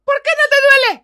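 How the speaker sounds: loud, high frustrated question; exasperated shout. Acted. Mother whose blows don't hurt the drunk, exasperated. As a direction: shout it in angry disbelief.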